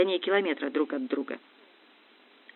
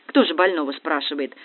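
usual speaking voice

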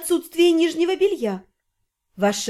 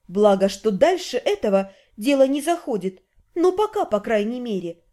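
usual speaking voice

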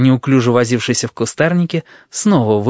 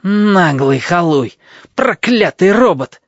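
cursing; 6 semitones higher, 3 dB louder, slower than usual. A narrator's reading. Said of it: "чертыхнулся Флезвелл".

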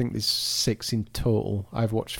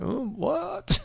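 high, incredulous oh what; baffled surprise. Retold or imitated. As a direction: jump up high in disbelief. Baffled by the thick accent.

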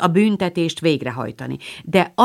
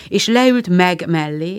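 plain reading voice

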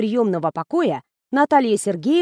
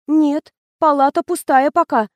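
higher, intimidated bleating; timid nurse, pitched up; frightened bleat; 5 semitones higher, 4 dB louder than usual. A narrator's reading. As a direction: bleat it like a cowed subordinate. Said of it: "проблеяла медсестра, явно напуганная".

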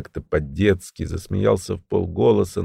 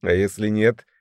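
confident, high-pitched taunting call; Весёлый, mocking; 8 semitones higher, 3 dB louder than usual. A narrator's reading. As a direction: jeer loudly from out in the street. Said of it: "издевательский, уверенный, громкий голос Весёлого".

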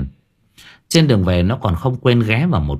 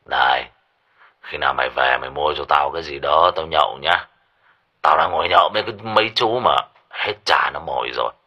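put-on low slurred drunk voice, rushed; a hint of a tipsy father; drunken slur; 5 semitones lower, faster than usual. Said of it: "giọng nói nhà lè nhè say xỉn".

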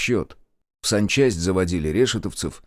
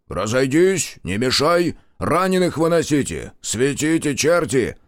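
loud, higher barked orders to the crowd; commander's roar; 6 semitones higher, 3 dB louder than usual. A narrator's reading. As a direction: roar at the men to clear the way. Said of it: "рык Маркова".

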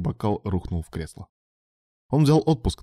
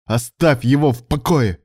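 "Оставь его в покое!" shouted louder and slower, "его" hit hard; furious order, dragged out; exploding; 5 semitones higher, 6 dB louder, slower than usual. A narrator's reading. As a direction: blow up and slam each word. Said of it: "взорвался Илья".

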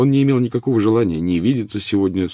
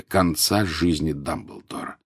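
plain reading voice

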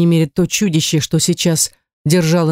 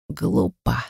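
soft, broken wheeze; hoarse croak; 7 dB quieter than usual. A narrator's reading. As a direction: croak it out weakly. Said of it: "прохрипел Завьялов".

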